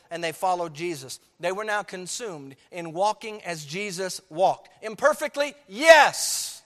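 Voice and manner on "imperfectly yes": rising preacher fervor. Acted.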